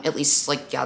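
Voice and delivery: ordinary talk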